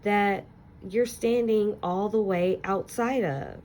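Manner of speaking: put-on expressive read-aloud to children, lingering on key words; story narration. Teacher reading a picture book to her class, expressive storytelling.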